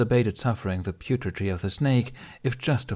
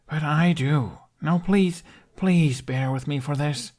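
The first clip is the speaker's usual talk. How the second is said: higher pleading, slowing on the second please; begging; 7 semitones higher, 3 dB louder, slower than usual. A narrator's reading. Hiccup begs Toothless to trust him, pleading higher. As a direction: plead gently, draw out the last please. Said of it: "Hiccup begged".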